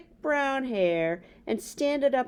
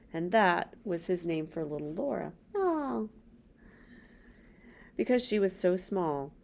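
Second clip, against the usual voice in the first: plain reading voice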